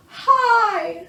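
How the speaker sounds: booming schoolmistress dragon; Madam Dragon. A narrator's reading. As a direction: call it out like a grand dragon teacher. Madam Dragon teaching flying.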